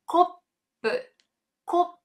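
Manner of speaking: kopu with a small stop before pu; pause in kopu. Shown as a demonstration. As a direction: hold a tiny glottal pause between ko and pu in kopu. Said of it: "Ele dá uma pausazinha".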